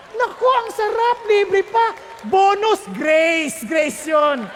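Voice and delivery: loud exclaimed grace; shouted grace. Real, not acted. Preacher exults to congregation about grace, shouting. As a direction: proclaim it loudly.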